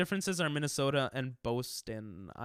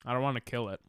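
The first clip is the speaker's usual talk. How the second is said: ordinary talk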